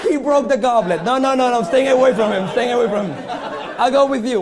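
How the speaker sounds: loud, laughing mock alarm, no no no; hammed-up fright, backing away laughing; mock panic. Magician pretending to flee the goblet-breaking volunteer, mock panic.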